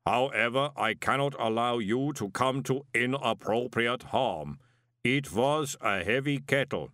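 measured, rumbling inhuman pronouncement; stern golem. A narrator's reading. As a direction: state it like a clay giant.

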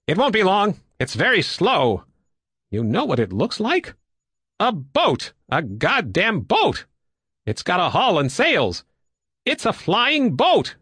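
high, amazed exclamations, punching the key words; excited pilot. Acted. Woodbine spotting a flying boat, high and excited. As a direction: marvel in a high, swooping voice.

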